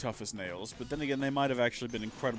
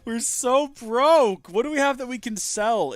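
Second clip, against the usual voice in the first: exasperated outburst; 11 semitones higher, 11 dB louder, slower than usual. Real, not acted. Laments having no money.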